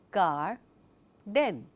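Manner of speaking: garden slowly split as gar, then den; split into syllables. Shown as a demonstration. Teacher splitting garden for the chin test. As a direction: stretch garden into two beats. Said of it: "Let me say it in the normal speed".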